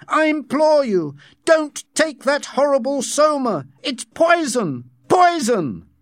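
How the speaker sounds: high, loud impassioned warning; shouted plea. A narrator's reading. Savage warning twins off soma, shouted plea. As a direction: implore them at the top of your voice. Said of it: "he shouted".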